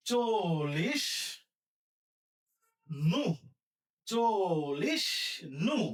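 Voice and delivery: চলিস drawn out as cho-lish, pause, then নু; syllable-by-syllable pronunciation. Shown as a demonstration. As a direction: stretch চলিস and set নু apart. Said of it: "তাহলে উচ্চারণ হবে কি".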